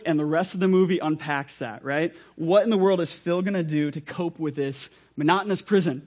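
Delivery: ordinary talk, nothing marked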